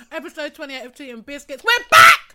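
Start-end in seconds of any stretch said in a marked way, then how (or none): 1.6-2.4 loud, high, excited cheer; excited shout. Real, not acted. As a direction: shout it in with joy. Excited show opening.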